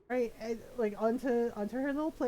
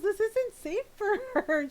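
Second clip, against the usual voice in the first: worried; 9 semitones higher, 3 dB louder than usual. Retold or imitated. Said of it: "she goes".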